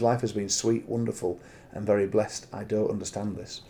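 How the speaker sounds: a quiet, measured reading of a confessional passage; reading aloud. Acted. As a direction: read it like a diary entry, inwardly. Reading a book excerpt aloud.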